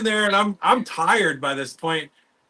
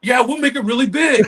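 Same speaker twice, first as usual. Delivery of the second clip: louder, sarcastic parroting; mimicking the sculptor's promise, sarcastic and a touch louder; mocking echo; 6 semitones higher, 5 dB louder than usual. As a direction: echo him back mockingly. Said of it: "I'm like".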